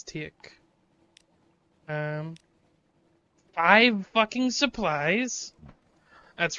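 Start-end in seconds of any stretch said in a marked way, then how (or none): 3.5-5.5 surprised. Real, not acted. Stunned at loot count.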